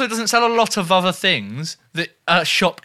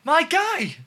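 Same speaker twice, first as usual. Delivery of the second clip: mocking voice; 12 semitones higher than usual. Mock telling off, high put-on voice.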